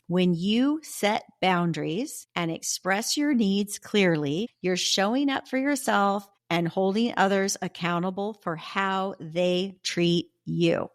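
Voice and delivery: slow, deliberate, word by word; emphatic repetition. Shown as a demonstration. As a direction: spell it out slowly so each phrase lands. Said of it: "I'm gonna say that again".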